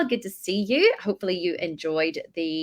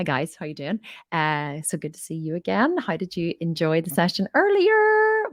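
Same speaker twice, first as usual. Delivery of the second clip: ordinary delivery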